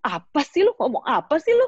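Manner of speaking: high, loud scoffing retort; high mocking dismissal of the father; rude dismissal. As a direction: brush him off rudely. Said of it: "aku cuma ngomong".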